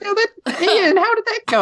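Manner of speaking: very high-pitched little squirrel. Acted. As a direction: squeak it out like a welcoming squirrel. Game master voices the squirrel.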